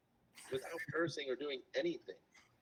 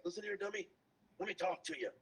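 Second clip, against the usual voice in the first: higher, brash trash-talk, lightly imitated; cocky promo voice; 7 semitones higher than usual. Young wrestler Sean mouthing off backstage, imitated higher and brash. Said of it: "If you can talk like that back here".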